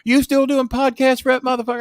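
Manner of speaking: hammed-up indignant caller; mock angry Jimmy. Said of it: "Jimmy's reply on the phone".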